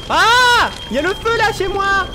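high, loud alarm; panicked scream. Real, not acted. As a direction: scream that the house is burning. Feu dans la maison. (crier paniqué au feu)

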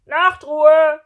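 very loud, high bellow; drawn-out, high shouted order; shouting. Acted. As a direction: yell it down the hallway. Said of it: "rief jemand anderes".

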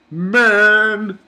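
big drawn-out mock cry; Chia. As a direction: belt it out in hammed-up imitation. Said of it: "I like when he does that".

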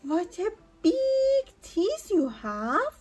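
put-on high, slow, loud little girl. A narrator's reading. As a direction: wonder at it like a small child.

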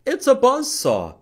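excited. Acted. Shadow puppet guesses, excited.

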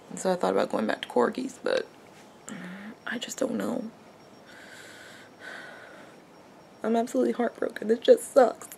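hushed tearful sobbing words; snotty crying. Real, not acted. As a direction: cry through it, almost whispering. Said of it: "I'm even crying on camera".

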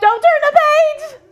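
high, loud pleading wail; whiny plea. Acted. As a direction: beg like a scared kid. Teacher mimics kids' plea.

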